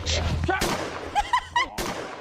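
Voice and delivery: shrieking laugh, way up high; high burst of laughter. Real, not acted. Shocked at the clip, a high shrieking laugh. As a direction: lose it at the clip.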